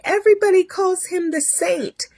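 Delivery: high-pitched imitation of a nurse. Nurse praising the surgeon to a patient, high put-on voice. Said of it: "She's like".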